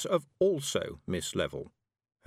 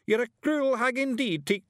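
shouting Feegle; 5 dB louder than usual. A narrator's reading. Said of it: "he shouted".

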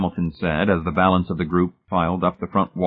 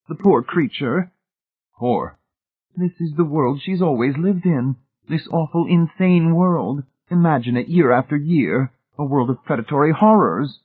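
high, louder lament in character; pitying Mrs Pritchett; 9 semitones higher, 5 dB louder than usual. A narrator's reading. Mrs Pritchett pities Miss Rice's insane world, high emphatic lament. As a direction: lift the voice in heartfelt pity.

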